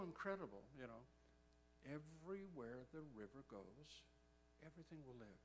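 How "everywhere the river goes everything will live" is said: hushed scripture quote. Retold or imitated. Quotes the verse that quickened him.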